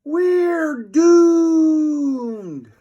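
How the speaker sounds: high, loud squeaky wail; falling boy shrieking in despair; doomed wail. A narrator's reading. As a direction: cry out in tiny-voiced terror. Falling off the roof.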